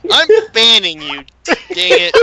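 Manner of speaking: mock outrage through laughter; giggling fake scolding; laughing. Real, not acted. Laughing protest at joke.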